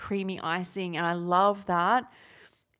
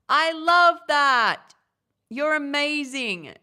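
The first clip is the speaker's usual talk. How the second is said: delighted; 6 semitones higher, 10 dB louder, slower than usual. Real, not acted. Reacting warmly to viewer comment.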